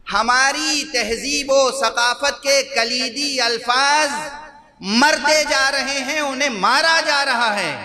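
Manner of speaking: high, ringing pulpit declamation; impassioned preaching. Real, not acted. Preacher laments key words dying.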